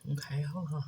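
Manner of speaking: quick hushed whisper. Real, not acted. Whispering an aside while she handles the food. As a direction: drop to a whisper for a moment. Pausing mid-cooking, aside.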